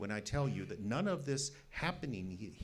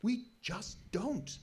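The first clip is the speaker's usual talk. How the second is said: pitched up, slow and pleading; high, slow, anguished insistence; desperate insistence; 11 semitones higher, much slower than usual. Acted. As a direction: despair over the missing evidence, dragged out. Frustrated scientist insists on lack of evidence.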